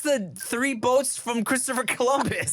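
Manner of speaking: high, slow, stalling delivery; hammed-up halting cover story; cheater faking a question. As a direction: improvise an innocent query very unconvincingly.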